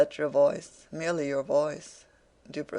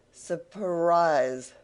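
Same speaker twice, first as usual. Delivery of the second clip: surprise stretched long and louder; drawn-out emphasis; 2 semitones higher, 6 dB louder than usual. A narrator's reading. Wegg springing his surprise on Venus, surprise stretched and loud. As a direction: lean on surprise, drawing it out. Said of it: "elongated and emphasized the word surprise".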